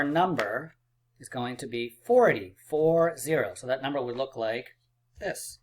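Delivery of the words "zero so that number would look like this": ordinary talk, nothing marked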